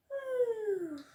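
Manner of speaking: high yawning sigh, falling away. A narrator's reading. Bella after her bedtime story, yawning. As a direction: let out a long sleepy yawn. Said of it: "I yawned again".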